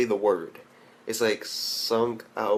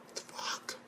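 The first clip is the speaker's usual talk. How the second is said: whispered disbelief; 20 dB quieter than usual. Real, not acted. Disbelief at plot twist.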